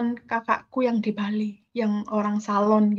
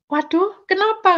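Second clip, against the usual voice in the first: higher-pitched startled question; lightly acted surprise, voice jumping up; retold startled concern; 8 semitones higher, 3 dB louder than usual. Man asks why she screamed so much, retold startled concern. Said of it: "Kok sampai jerit segitu".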